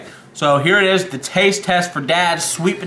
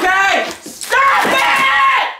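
panicked shout; much slower than usual. Real, not acted. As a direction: yell at him to stop, higher. Grandson yells at grandpa.

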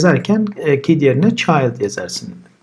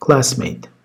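normal talking voice

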